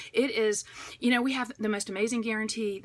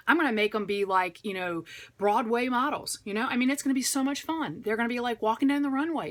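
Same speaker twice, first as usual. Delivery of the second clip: normal talking voice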